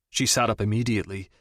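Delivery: ordinary delivery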